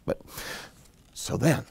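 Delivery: plain reading voice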